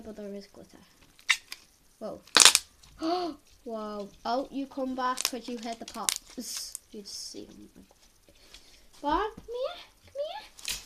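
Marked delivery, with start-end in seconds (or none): 2.3-3.4 a loud sudden gasp; startled loud gasp; sharp gasp. Real, not acted. Slime pops startle her. 9.0-10.8 high sing-song call to a pet; high coaxing pet call; calling the dog. Real, not acted. Calling her dog over.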